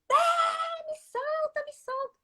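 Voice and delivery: high, shrill mock cry of a girl squirming free; protesting toddler. Little daughter refusing her mother's hug. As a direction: push mom away like a small child.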